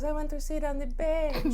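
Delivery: put-on a sweet little girl begging her father; Ducky voice. Guest does Ducky for the host, high and pleading. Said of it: "And she goes".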